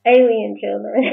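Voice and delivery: breaking into laughter mid-word; can't keep a straight face; laughing. Real, not acted. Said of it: "it's hard to keep a straight face".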